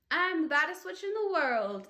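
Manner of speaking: loud, high witch yell; little witch yelling after a girl; yelled witch boast. A narrator's reading. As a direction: shout the boast as you chase her. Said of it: "yelled Ramona".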